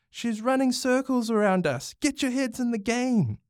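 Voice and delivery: high-pitched bossy yelling; angry shout. A narrator's reading. Bronx scolds his team for losing, shouting. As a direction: holler orders at slacking teammates. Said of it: "Bronx shouted".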